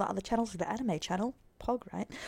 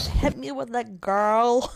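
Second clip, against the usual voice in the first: put-on slow, drawn-out TikTok famous accent, a touch louder; 4 dB louder, much slower than usual. Reacting to a video, hamming a TikTok famous accent. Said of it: "Sorry, that accent".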